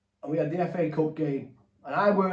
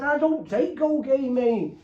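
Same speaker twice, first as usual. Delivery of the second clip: higher, slow, wide-eyed innocent protest; mock innocent denial; 9 semitones higher, slower than usual. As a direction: play it falsely innocent, dragging the words. Denying drug use at hearing.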